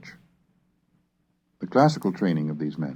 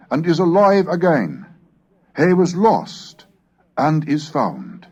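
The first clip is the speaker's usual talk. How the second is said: raised, expressive Bible reading; scripture reading; 7 semitones higher, 6 dB louder than usual. Acted. Lecturer reading the prodigal son to students, in a solemn scripture voice. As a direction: tell the parable as if from the pulpit. Reading the parable of Luke aloud.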